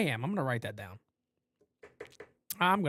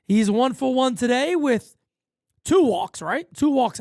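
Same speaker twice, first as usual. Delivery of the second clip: raised broadcaster call of the at-bat; play-by-play; 7 semitones higher, 5 dB louder, slower than usual. Acted. Streamer calling his baseball game, raised announcer voice.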